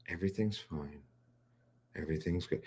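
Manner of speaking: drained of all energy, barely there; mock near-death weariness; low energy. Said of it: "you hear the non-energy in the voice".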